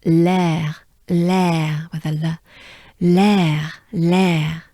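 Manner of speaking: "l'air" as French lèr, L joined to open e and throaty r. Shown as a demonstration. Teacher adding an L to the sound, French "l'air". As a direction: repeat "l'air" firmly with a French r. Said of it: "it becomes actually".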